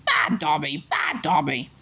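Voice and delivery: a mild mock elf shout. A narrator's reading. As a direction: grido appena accennato.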